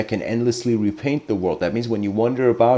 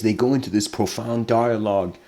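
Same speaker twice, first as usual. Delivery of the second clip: usual speaking voice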